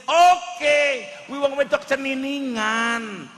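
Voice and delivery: high, loud, slow put-on voice. Preacher voicing a figure in a breakfast skit, loud and high. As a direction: drawl it out in a character voice.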